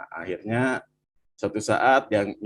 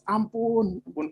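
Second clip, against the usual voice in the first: mild mock wail, pitched well up; retold plea for mercy; 10 semitones higher than usual. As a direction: act out a high-pitched begging cry. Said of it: "Anak-anak menjerit-jerit, orang tua".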